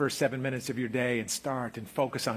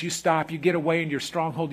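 usual speaking voice

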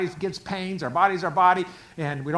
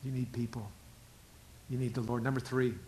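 ordinary talk, nothing marked

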